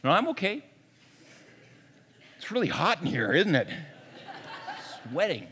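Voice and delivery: mock-stoic cover-up; pretending not to cry. Man in the cinema hiding his tears, mock stoic. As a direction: play at brushing off tears with lame excuses. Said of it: "pretending I'm not crying".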